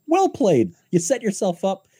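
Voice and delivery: high, bright mock admiration; excited praise. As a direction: cheer him on in a high voice. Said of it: "And I'm like".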